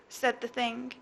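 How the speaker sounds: ordinary delivery, nothing marked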